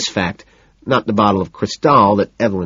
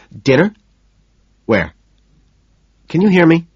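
louder, higher, checking the line; calling down the phone; 8 semitones higher, 5 dB louder than usual. A narrator's reading. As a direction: raise the voice into the phone. Patrick on bad phone line.

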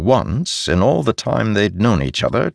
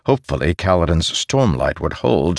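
plain reading voice

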